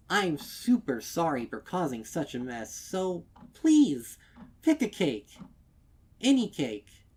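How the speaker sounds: high, loud and pleading; bubbly generosity; eager mayor. A narrator's reading. Mayor urging customers to pick a cake, eager.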